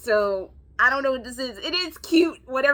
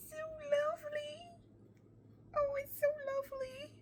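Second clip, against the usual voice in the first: tearful admiration; 13 semitones higher, 9 dB quieter than usual. Real, not acted.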